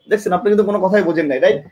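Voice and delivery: normal talking voice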